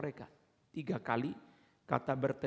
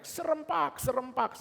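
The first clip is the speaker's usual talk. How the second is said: very high shouted word; comic group yell; 11 semitones higher, slower than usual. Retold or imitated. Children misunderstand and yell the word itself, high shout. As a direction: yell it together like a chant. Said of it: "Lalu mereka semua teriak".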